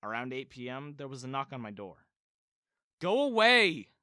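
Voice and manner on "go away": loud high shout; yelled. A narrator's reading. Son yelling at a knock on his door, loud shout. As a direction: yell it through the closed door. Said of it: "I yelled".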